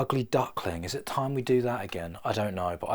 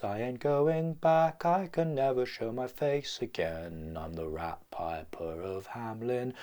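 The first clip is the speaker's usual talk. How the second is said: slow, haunting voice of the Rat Piper; dark storyteller's rat piper, slow and haunting; 4 semitones higher, slower than usual. Acted. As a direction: brood slowly, haunted and alone. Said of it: "Guillermo del Toro".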